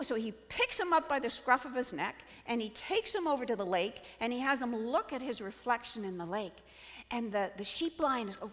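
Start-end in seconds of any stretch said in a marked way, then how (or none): none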